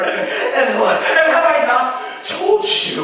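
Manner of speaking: high, loud, slow manic plea; manic insistence. A narrator's reading. Narrator insisting he is not mad, high and manic. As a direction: hammer it home, half laughing, unhinged.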